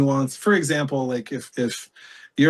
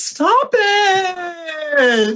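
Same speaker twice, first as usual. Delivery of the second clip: very high, drawn-out loud squeal; hammed-up high-pitched mock protest; comic squeal; 5 dB louder, much slower than usual. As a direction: squeal it playfully, stretching every word. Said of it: "said it just like him".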